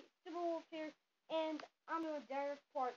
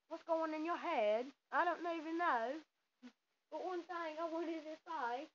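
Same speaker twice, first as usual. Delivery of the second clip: amateur put-on British accent; American badly faking a British accent; hammed-up mock British voice. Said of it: "told me to do a British accent".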